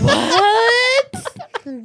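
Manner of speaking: high, loud laughing what; laughing disbelief. Real, not acted. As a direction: crack up in disbelief. Phone goes to do not disturb.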